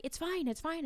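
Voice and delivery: high, soft imitation of a student; student's reassurance. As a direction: wave off the apology lightly. Student waves away the apology.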